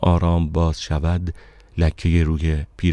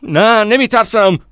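high, loud, defiant shout; 8 dB louder than usual. A narrator's reading. Narrator denying fear to the stranger, shouting. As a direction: shout the denial at full force. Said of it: "با فریاد گفتم".

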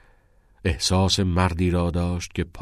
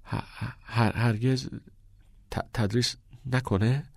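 broken, halting stammer, higher; 5 semitones higher, 3 dB quieter than usual. Acted. Todd stammering a question to the headmaster, halting and broken. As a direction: trip over every first syllable. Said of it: "به من و من افتاد".

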